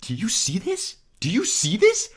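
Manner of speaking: very high-pitched disbelief; incredulous. Real, not acted. Player pointing out three stunned teammates to viewers, incredulous. Showing viewers the triple stun.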